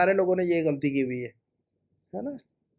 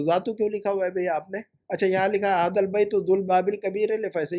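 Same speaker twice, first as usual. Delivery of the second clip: usual speaking voice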